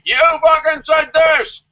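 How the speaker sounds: high, loud, slow mock outrage; angry accusing shout. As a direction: yell it like an outraged commenter. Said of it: "the experts are gonna be like".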